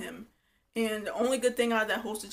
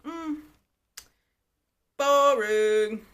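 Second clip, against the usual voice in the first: drawn-out, louder "boring"; bored dismissal; 4 semitones higher, 8 dB louder, much slower than usual. Retold or imitated. Dismissing the dull couple, drawn out. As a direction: dismiss them with a long drag. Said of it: "I'm just like".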